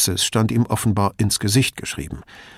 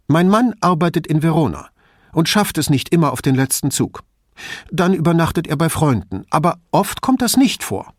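higher-voiced anxious mother, pressing on; a worried mother confiding in the detective, higher; 7 semitones higher, 5 dB louder than usual. A narrator's reading. Mother explains.

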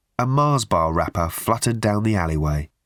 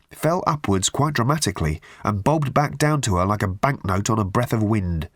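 ordinary delivery

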